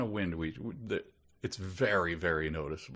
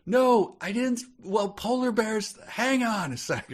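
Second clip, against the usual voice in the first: shouting over music; 4 dB louder than usual. Retold or imitated.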